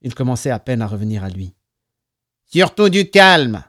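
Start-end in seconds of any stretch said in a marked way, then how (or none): 2.5-3.6 slow, loud nasal order; higher, ringing nasal command. A narrator's reading. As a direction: draw it out through the nose. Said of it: "résonna la voix nasillarde de Jack Paul".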